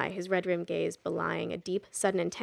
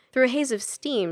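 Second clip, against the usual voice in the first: ordinary delivery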